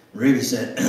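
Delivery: usual speaking voice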